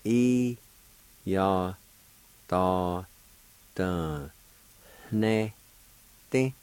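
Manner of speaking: "E, ya, ta, ta, ne, te" slowly, long gaps between syllables. Shown as a demonstration. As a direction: sound out "E, ya, ta, ta, ne, te" with a nasal N in "ne". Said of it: "has a nasal sound to it".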